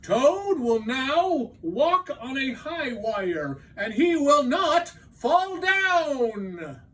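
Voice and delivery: high, slow, shouted showman announcement; booming announcer. Acted. Strange voice announcing the dream star, booming and slow. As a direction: call it out like a circus ringmaster. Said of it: "said the voice".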